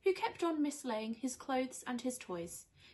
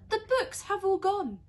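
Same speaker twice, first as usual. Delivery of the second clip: high, loud cry of dismay; alarmed cry; 10 semitones higher, 9 dB louder than usual. Acted. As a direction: wail it out in shock. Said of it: "cried out Peter".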